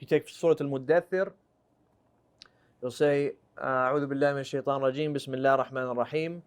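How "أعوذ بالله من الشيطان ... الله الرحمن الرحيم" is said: rushed recitation. Shown as a demonstration. Rapid formulaic recitation before verse.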